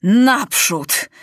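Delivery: rousing battle cry; young king yelling forward; shout. Acted. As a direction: call the charge. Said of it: "Krzyknął".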